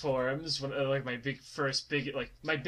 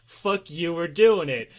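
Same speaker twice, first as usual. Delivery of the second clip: retold defiant comeback, getting louder; mock defiance; 2 semitones higher, 6 dB louder, slower than usual. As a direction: imitate a friend stubbornly insisting. Retold quarrel, defiant reply.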